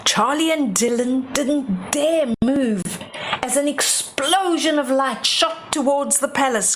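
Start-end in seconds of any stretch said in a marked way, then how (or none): none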